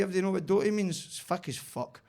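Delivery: ordinary talk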